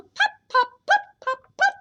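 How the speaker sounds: bright high-pitched popping; high pops. Acted. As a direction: let each pop spring up. Ducklings pop back up.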